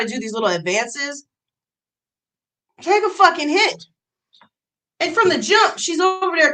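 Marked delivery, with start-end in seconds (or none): none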